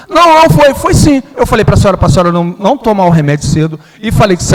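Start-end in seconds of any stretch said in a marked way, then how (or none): 0.1-1.2 mother protesting. Retold or imitated. Mother arguing back.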